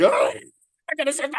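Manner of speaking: high, whiny mock alarm at waking up; mocking sudden panic. Mocking newly woken people, high mock alarm. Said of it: "suddenly they're like".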